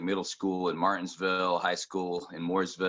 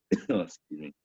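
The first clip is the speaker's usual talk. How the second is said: coughing. Real, not acted. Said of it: "Cough there".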